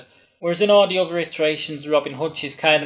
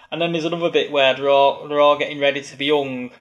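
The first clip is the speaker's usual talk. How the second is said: ordinary talk, nothing marked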